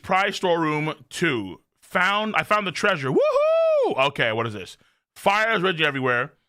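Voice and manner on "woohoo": excited whoop. Real, not acted. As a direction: whoop like you just hit the jackpot. Finds treasure in game.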